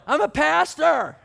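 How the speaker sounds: loud, high, slow shout of I'm a pastor; shouted over pub noise. Retold or imitated. Pastor shouting his job to an Irishman in a loud pub. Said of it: "Well, he's more like".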